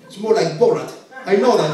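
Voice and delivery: ordinary talk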